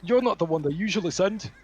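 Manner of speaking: put-on high, loud old man's voice; old servant challenging a visitor at the door, high and loud; suspicious old doorman. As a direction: snap at the stranger like a wary elderly butler. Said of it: "and he goes".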